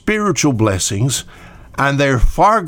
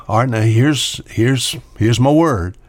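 normal talking voice

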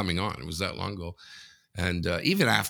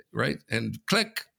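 ordinary talk

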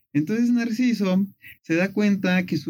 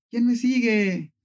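slow, higher mock shout; called-out question; 7 semitones higher, slower than usual. Narcissus calls into the valley, slow higher mock shout. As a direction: call out to the unseen follower. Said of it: "y grita".

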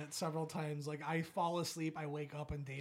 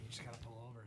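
much softer, sickly, barely getting the words out; weak queasy plea; 6 semitones lower, 12 dB quieter, much faster than usual. Retold or imitated. Telling his buddy to pull over, soft and queasy. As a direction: sound like you're about to throw up. Feeling sick, about to throw up.

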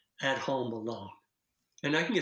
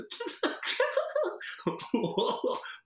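high wheezy laugh sliding down; contagious laugh. Shown as a demonstration. Laughter coach showing another kind of contagious laugh. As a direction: squeeze out a silly, very high laugh. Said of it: "Or it might be just".

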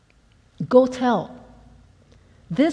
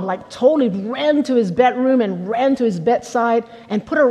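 normal talking voice

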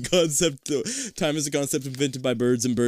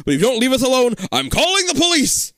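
angry threat; 11 semitones higher, 5 dB louder than usual. Acted. Father threatens police.

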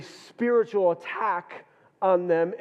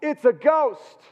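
higher, louder cry of alarm; frightened cry; 8 semitones higher, 5 dB louder, slower than usual. Acted. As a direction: cry it out like terrified men seeing a ghost. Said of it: "they cried out".

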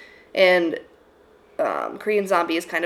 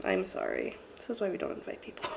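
whispered laugh; 14 dB quieter than usual. Real, not acted. Embarrassed aside.

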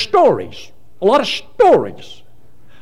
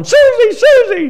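high, loud holler; hollering Susie; 6 dB louder, slower than usual. Retold or imitated. Second inmate hollering down the hallway. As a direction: wail the name down the hall. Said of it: "was hollering".

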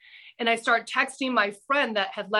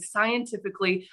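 normal talking voice

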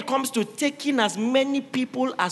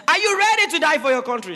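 loud high commander's cry; army commander rallying his soldiers loudly; rallying shout; 7 semitones higher, 5 dB louder, faster than usual. Acted. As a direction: rouse the troops before battle. Second commander rallies army.

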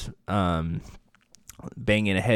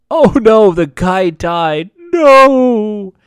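loud, high, drawn-out wail of fake dismay; hammed-up "oh no" building to a long cry; mock horror; 8 semitones higher, 9 dB louder, much slower than usual. Recapper mourning the stabbed henchman, mock-horrified wail.

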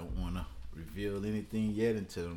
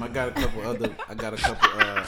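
laughing; 4 semitones higher, 12 dB louder, faster than usual. Real, not acted.

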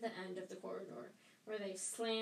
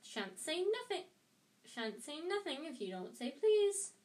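annoying high singsong; needling playground chant; Peeves; 7 semitones higher than usual. A narrator's reading. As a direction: taunt him, swooping up like a bratty imp. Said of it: "in his annoying singing voice".

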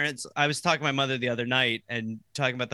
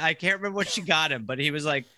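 ordinary talk

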